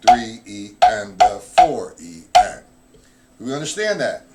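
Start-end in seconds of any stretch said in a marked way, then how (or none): 0.1-2.6 loud, slow, even counting aloud. Shown as a demonstration. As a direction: count each beat out like a drum teacher. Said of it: "Now we put the fill in".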